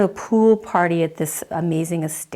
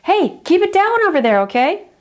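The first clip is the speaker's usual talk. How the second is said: yell over hedge; 9 semitones higher than usual. Retold or imitated. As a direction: shout across to the noisy kids, still polite. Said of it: "you just kind of yell over the hedge".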